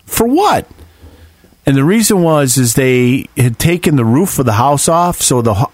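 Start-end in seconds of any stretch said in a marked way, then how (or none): 0.1-0.7 retold disbelief. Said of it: "I said".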